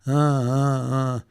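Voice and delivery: low mindless "uh" drone; careless droning. Retold or imitated. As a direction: drone wordlessly, imitating careless chanting. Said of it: "I was just like".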